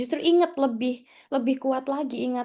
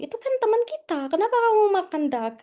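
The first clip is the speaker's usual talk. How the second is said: much higher-pitched objection; pleading protest; 8 semitones higher, faster than usual. Retold or imitated. Student scolding housemate over eating a friend, pleading retold protest. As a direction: act out appalled disbelief, pitched right up.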